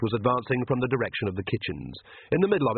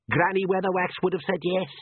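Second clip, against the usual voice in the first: usual speaking voice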